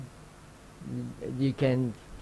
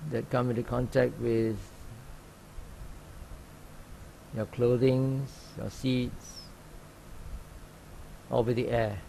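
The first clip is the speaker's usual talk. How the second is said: calm, even meditation guide with long pauses; unhurried instructions, leaving long silences between phrases; guided meditation; 7 dB quieter than usual. Real, not acted. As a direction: gently guide sitters into open awareness. Leads a sitting meditation.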